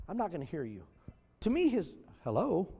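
usual speaking voice

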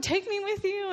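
put-on high, pleading old lady voice. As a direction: beg like a little old lady. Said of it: "And she was like".

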